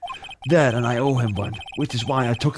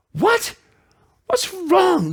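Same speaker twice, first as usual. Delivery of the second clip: much higher, hammed-up alarm; alarmed lawyer; much slower than usual. Rookie lawyer alarmed by his client, high mock alarm.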